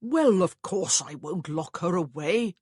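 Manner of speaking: slow, loud brother's voice; slowly and loudly reassure the child; lightly voiced Augustine. A narrator's reading.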